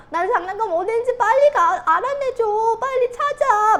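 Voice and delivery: loud, high-pitched tearful whining demand; mock crying child. Child demanding mom find the toy, mock bawling. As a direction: imitate a bawling kid pestering mom. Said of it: "막 이러고 우는데".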